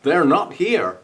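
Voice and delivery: a hint of that film accent; film character quote. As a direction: mimic the strange film character. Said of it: "a very strange looking character opening a box and going".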